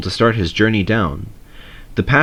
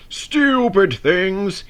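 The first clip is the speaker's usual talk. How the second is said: much higher, slow grumbling engine; slow, higher grumble; 10 semitones higher, much slower than usual. A narrator's reading. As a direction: grumble it out like a sulky show-off. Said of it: "he grumbled".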